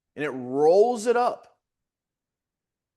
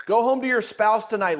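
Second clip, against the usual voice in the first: normal talking voice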